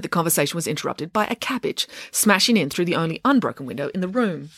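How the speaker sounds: ordinary delivery, nothing marked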